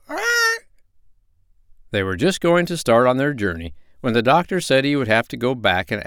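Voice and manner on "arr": very high squawking arr; pirate parrot arr. Acted. Parrot ends her sea song.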